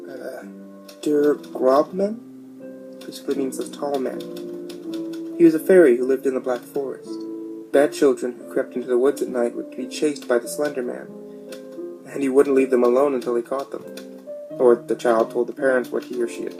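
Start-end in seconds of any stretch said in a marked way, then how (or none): none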